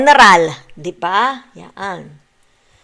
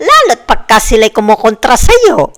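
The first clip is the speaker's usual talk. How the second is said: louder emphasis; 9 dB louder than usual. Real, not acted. Emphatic point while still laughing.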